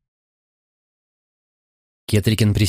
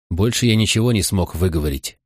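usual speaking voice

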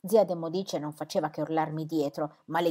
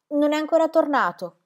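higher, strained cry; girl answering the athlete in a choked cry; 7 semitones higher, 4 dB louder than usual. Acted. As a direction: force it out through a tight throat. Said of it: "gridai con voce strozzata".

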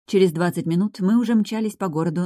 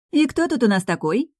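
higher, playful greeting; lively, delighted question; exclaiming nurse; 6 semitones higher, 3 dB louder than usual. A narrator's reading. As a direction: exclaim to a small visitor. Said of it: "воскликнула медсестра Мила".

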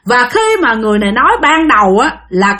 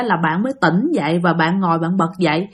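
plain reading voice

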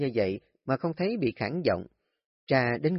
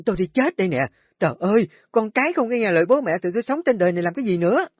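much higher, loud and fast, wailing hysterically; screaming lament; 8 semitones higher, 6 dB louder, much faster than usual. Acted. Wife rushing to the gate screaming at husband, hysterical wailing. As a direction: shriek it while storming out the gate. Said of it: "vừa la lớn".

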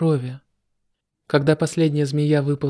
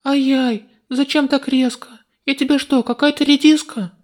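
put-on high, louder indignant girl; indignant yelps; 10 semitones higher, 4 dB louder than usual. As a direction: squeal in annoyed protest. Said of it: "мои разозлённые выкрики". (возмущённые девичьи выкрики)

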